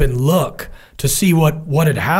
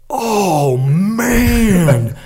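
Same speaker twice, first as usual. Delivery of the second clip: a higher, pained oh man; stunned groan; 5 semitones higher than usual. Retold or imitated. Comms guy staring through binos, stunned and dismayed. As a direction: let it sink in with dismay.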